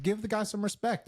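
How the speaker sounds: high, indignant plea; mock indignant. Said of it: "I was like".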